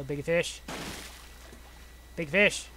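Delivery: normal talking voice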